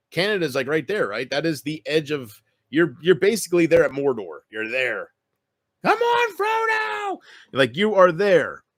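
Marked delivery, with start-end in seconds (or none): 5.8-7.2 very high, shouted cheer urging Frodo on; Samwise cheer. Pretend. Streamer cheering Frodo on at Mordor, high shouted cheer. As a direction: squeal it like a cheering hobbit.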